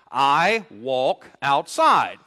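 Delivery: slow, deliberate example sentence; normal English order. Shown as a demonstration. Preacher showing normal English word order. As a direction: spell out the plain word order slowly. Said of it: "if I was gonna describe my exercise habits".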